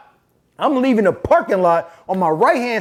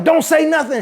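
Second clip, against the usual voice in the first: higher, insistent retold interjection; urgent hushing; 7 semitones higher than usual. Woman stopping the nurse from answering, urgent hushing. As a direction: act out a quick, urgent cut-in. She cuts off the nurse again.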